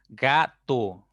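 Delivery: "Gato" split into ga - to; syllable by syllable. Shown as a demonstration. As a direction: say "Gato" in two separate beats, ga, to. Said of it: "ya tienen dos sílabas".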